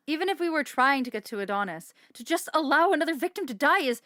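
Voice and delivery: loud, rising protest; upset outburst. Acted. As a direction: shout it out in dismay. Protests letting a victim die.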